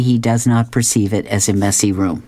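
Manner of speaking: plain reading voice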